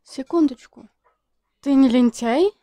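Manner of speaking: ordinary talk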